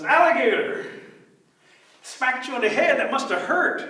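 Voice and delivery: high, loud put-on voice; disguised rabbit. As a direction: sing out to the gator, needling. Disguised rabbit needles alligator.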